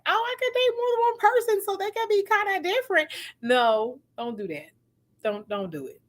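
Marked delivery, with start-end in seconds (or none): 0.1-3.1 high, airy voice mocking naive excitement; mock naive quote. Mocking a viewer's naive dating idea, high airy tone. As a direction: play the giddy new dater. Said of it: "to be like".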